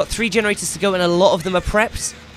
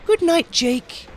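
mock creepy farewell; 9 semitones higher, much slower than usual. That's creepy as fuck.

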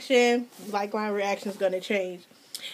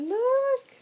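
high excited look; 12 semitones higher than usual. Real, not acted. Showing card.

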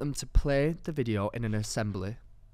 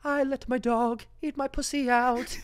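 higher, theatrical sung-out proclamation; mock musical line; 11 semitones higher than usual. Sarah's big line in the imagined musical, theatrical. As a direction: belt it like a show tune. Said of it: "turned this into a musical".

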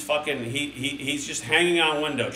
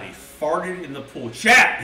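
ordinary talk

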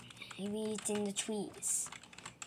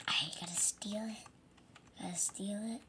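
sneaky whisper; 7 dB quieter than usual. Acted. Sneaking out with stolen snacks.

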